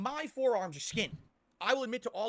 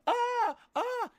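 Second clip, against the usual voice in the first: two short high loud yelps, much higher than his voice; mock pained yelps; 7 dB louder than usual. Retelling a trailer scene, Donatello stabbed yelps high and loud. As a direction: yelp like a cartoon turtle stabbed in the leg. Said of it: "and he goes".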